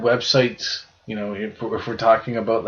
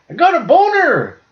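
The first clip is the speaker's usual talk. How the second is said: put-on much higher, louder toddler exclamation; proud little kid; 6 dB louder than usual. Retelling the bow-and-arrow video, squeaky proud toddler shout. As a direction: blurt it out like a proud three-year-old. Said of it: "He's like".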